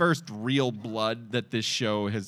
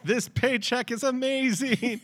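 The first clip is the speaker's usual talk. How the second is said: high-pitched gleeful impersonation; mock delight; 11 semitones higher than usual. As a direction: crow it up high, hammed-up. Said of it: "she's also like".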